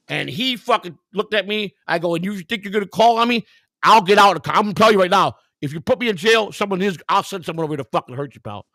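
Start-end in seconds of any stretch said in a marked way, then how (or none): 2.1-8.7 raised, higher threat, reenacting a screaming confrontation; loud, high retold tirade, short of screaming; retold screamed threat. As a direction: re-enact yelling threats at someone. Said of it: "heard me screaming at the top of my lungs".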